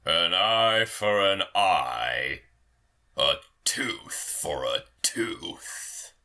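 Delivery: low, deliberate verdict; vengeful pronouncement. Acted. Lord answering the lady with vengeance, low and deliberate. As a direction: pronounce it low with weighty pauses.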